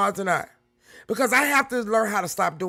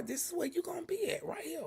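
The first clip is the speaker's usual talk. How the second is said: higher, breathy whispered aside; 8 semitones higher, 14 dB quieter than usual. Real, not acted. Streamer telling loyal viewers where they belong, whispered. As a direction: whisper it like a secret. Closing intimate point to viewers.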